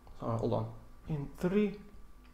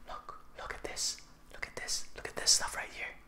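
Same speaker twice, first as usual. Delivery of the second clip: whisper; 18 dB quieter than usual. Real, not acted. Whispering to sister at screen.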